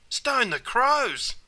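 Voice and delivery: high, drawn-out cry of surprise; surprised exclamation. Shown as a demonstration. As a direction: exclaim it slowly, pitched right up. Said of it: "This is also an expression of surprise".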